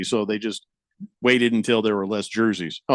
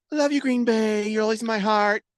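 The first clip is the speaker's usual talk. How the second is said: a lightly raised, sugary goodbye voice; higher mock-sincere thank you; mock sweet farewell; 8 semitones higher than usual. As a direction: coo a fond farewell.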